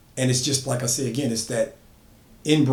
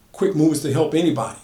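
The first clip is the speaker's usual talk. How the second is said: ordinary delivery, nothing marked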